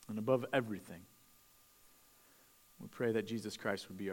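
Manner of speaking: soft, reverent prayer voice, fading low. Real, not acted. Pastor leads the congregation in prayer, hushed and reverent. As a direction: pray it quietly before the reading.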